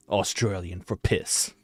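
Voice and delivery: put-on Australian ad read; Aussie accent. Shown as a demonstration. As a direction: drop into a mock Aussie tagline. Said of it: "a smidge of a Aussie accent".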